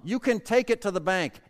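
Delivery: ordinary delivery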